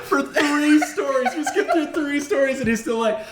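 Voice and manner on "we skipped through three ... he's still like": talking through laughter; laughing. Real, not acted. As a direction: laugh while you say it. Laughing while describing the video.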